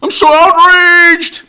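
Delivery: slow, overblown fake fury building up; mocking angry voters howling their outrage. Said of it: "Everything is apoplectic".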